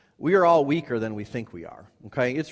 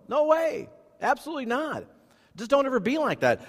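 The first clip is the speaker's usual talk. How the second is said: pitched way up, insistent no way; emphatic high warning; 12 semitones higher than usual. Real, not acted. Preacher warning his church, high and insistent. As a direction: push it hard so the church listens.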